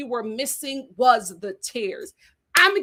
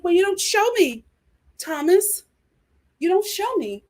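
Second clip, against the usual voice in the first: higher, louder, lightly voiced complaining Becca; mock Becca whine; 7 semitones higher, 4 dB louder than usual. Becca whining at Thomas that he doesn't show her. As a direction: whine it as a half-hearted reality star.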